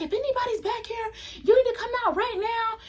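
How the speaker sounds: high mock call to the backyard; imitating stepmom calling. Stepmom calling into the backyard, high mock demand. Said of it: "she comes outside and she's like".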